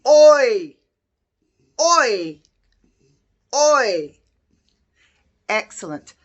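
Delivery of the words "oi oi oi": a loud, punchy "Oi". Shown as a demonstration. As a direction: call out "Oi" loudly, like a teacher modelling it. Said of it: "let's do a loud voice".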